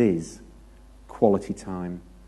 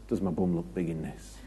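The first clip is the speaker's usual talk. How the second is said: mock wife; 4 semitones lower, 7 dB quieter than usual. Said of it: "your missus says".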